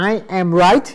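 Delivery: wrong form, question shown only by the rising "right"; rising question tune. Shown as a demonstration. Teacher contrasts the wrong rising statement. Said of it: "แทนที่จะเป็น".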